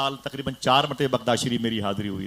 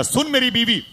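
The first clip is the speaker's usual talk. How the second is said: calling out; 10 semitones higher, 3 dB louder than usual. Retold or imitated. Husband calling to wife.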